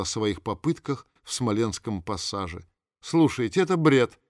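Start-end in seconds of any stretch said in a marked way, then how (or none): 3.0-4.2 loud, indignant outburst; exclaiming. A narrator's reading. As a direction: cry out in disbelief. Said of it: "воскликнула Инна Кирилловна".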